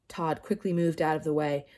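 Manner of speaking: usual speaking voice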